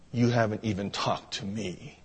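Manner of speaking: low, very soft murmur; subdued, quiet reproach; under her breath. Retold or imitated. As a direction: say it barely aloud, low and hurt. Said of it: "under her breath".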